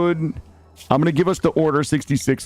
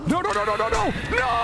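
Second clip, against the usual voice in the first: high, rapid-fire panicked refusal; high-pitched panic; panicked cry. Real, not acted. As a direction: cry out in mounting alarm. Panicking as game goes wrong.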